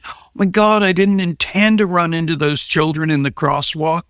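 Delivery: high, whiny fake innocence; mock shocked excuse. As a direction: play the driver pleading he meant no harm. Mock excuse of careless driver.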